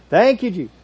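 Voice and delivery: high, loud exasperated exclamation; substitute cuss word. Acted. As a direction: blurt it as if swearing. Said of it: "said that instead of the cuss word".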